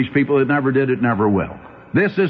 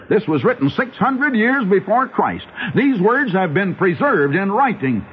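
higher, pressing pulpit appeal; fervent preaching; 5 semitones higher than usual. Acted. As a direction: corner them with why.

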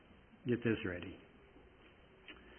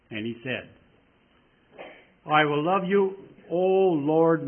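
psalm reading; 2 semitones higher, 4 dB louder than usual. Acted. Preacher reading David's psalm aloud, raised and measured. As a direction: proclaim the heading and David's cry.

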